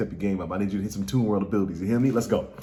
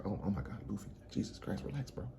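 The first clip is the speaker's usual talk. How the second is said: very soft, nearly whispered aside; stunned little mutter, barely voiced; hushed disbelief; 18 dB quieter, faster than usual. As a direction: murmur it under your breath. Hushed reaction to Luffy's outburst.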